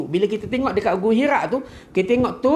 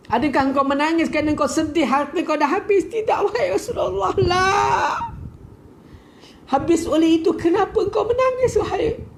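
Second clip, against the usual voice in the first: tearful plea; 10 semitones higher than usual. Retold or imitated. Dramatic retelling, cry heard.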